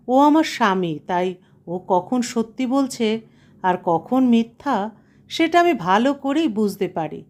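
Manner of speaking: ordinary delivery, nothing marked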